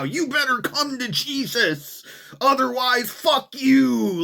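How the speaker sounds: high, slow mocking preacher shout; mock zealot yell. Mocking street preachers yelling at mosque worshippers, high and slow. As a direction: ham up a street zealot scolding. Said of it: "screaming at him about how".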